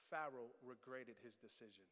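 usual speaking voice

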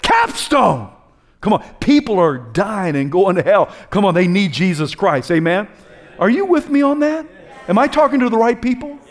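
loud, high preacher's call; fiery preaching. Real, not acted. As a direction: rouse the congregation at full force. Preaching at full force.

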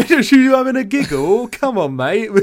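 high, loud British lad banter; hammed-up, giggly British lad accent; mock British lad. Playing British lad, trading banter.

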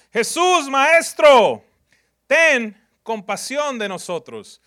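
loud, high desperate cry for mercy. Acted. Lepers calling from a distance, loud and high pleading cry. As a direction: call out from far off, begging.

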